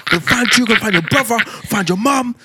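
high, loud South London threats; South London rage. Retold or imitated. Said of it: "I'm all South London on the phone like".

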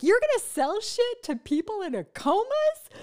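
high, loud mock disbelief; loud, hammed-up outraged question; incredulous guy friend. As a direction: imitate a buddy heckling, pitch shooting right up. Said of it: "Where I talk to my guy friends".

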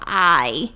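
"ai" as a drawn-out eye sound; ai of pain, demonstrated. Shown as a demonstration. Teacher likening letter I to a bumped-leg ai. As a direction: say "ai" like a mild mock ouch. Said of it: "Sabe o nosso ai?".

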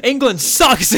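high, loud heckle breaking into laughter; gleeful mock insult, loud and high; mock jeering shout. Jokey outburst insulting England.

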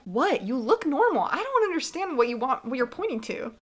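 high, insistent confused protest; mock bafflement. Viewer who missed the tear answers Quinn, mock bafflement. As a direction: protest that there is nothing to see.

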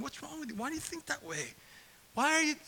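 high, baffled exasperation; exasperated. Retold or imitated. Pastor exasperated at the young generation, high and incredulous. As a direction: throw up your hands at them. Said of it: "and I'm like".

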